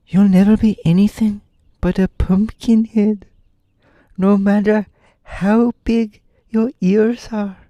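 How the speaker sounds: high-pitched, mock-grave boy; Tip's grave high voice. A narrator's reading. Tip gravely breaking news to Jack, high mock-grave boy voice. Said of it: "said Tip gravely".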